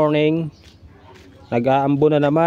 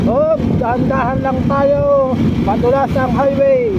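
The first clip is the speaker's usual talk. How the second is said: raised voice; 6 semitones higher, 5 dB louder than usual. Real, not acted. Riding on rainy highway.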